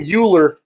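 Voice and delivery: Euler mispronounced as YOO-ler; common mispronunciation. Shown as a demonstration. Teacher warns about miscalling the mathematician, Euler as YOO-ler. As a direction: begin Euler with a "you" sound. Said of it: "Everyone wants to say Euler".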